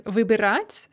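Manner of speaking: wybierać stressed on its last syllable, the a; stress on final syllable. Shown as a demonstration. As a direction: put the weight on the final a of wybierać. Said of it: "has the stress on the final syllable".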